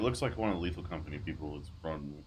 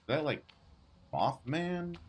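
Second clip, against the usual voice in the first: surprised; 12 semitones higher, slower than usual. Real, not acted. Spots a strange monster.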